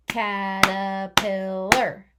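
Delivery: Caterpillar said slowly and loudly, syllable by syllable; stretched out. Shown as a demonstration. Teacher sounding out Caterpillar for the class, stretched slow. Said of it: "Let's stretch it out".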